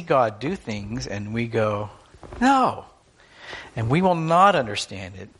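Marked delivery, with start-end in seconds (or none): 2.4-2.8 high, loud protesting no; a sudden high loud no. Pretend. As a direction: cry out a sharp refusal. Voicing protest against God.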